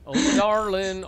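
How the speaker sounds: a hammed-up sigh of love; mock swoon. First lovesick cry to his co-caster, a mock swoon. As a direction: start the lovesick line small.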